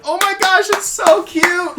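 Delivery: very high, loud squeal of delight; squealing girls. Retold or imitated. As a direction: shriek it like giddy fangirls. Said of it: "the girls are like".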